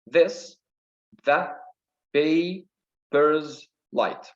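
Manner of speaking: very slow, broken into beats; syllable by syllable. Shown as a demonstration. Teacher counting a line's syllables, syllable by syllable. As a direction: count out "paper's light" piece by piece. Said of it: "Here we got five syllables".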